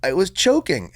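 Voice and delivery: put-on little boy explaining himself; child voice.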